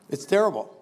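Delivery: ordinary talk, nothing marked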